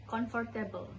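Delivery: Indonesian-style "comfortable", read as spelled; Indonesian pronunciation. Shown as a demonstration. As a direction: say "comfortable" the Indonesian way, "con-for-teh-bel". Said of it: "In Indonesian, we usually say it like".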